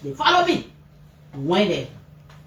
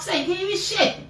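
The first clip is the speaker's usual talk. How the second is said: ordinary talk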